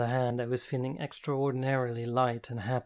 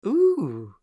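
loud, higher delighted ooh; gleeful coo; 8 semitones higher, 10 dB louder than usual. Acted. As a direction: coo with glee. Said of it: "Jen cooed gleefully".

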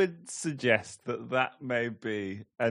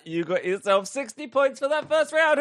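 rising gleeful host call; excited announcing; 11 semitones higher, 5 dB louder than usual. Acted. Host hyping the contestant's score, excited. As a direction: build up to the score.

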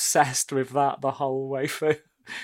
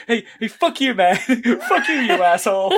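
high, raised-voice taunts through laughter; mock yelling; 7 semitones higher, 6 dB louder than usual. Retelling him running away from the burning car, mock yelling. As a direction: hurl insults in a hammed-up yell.

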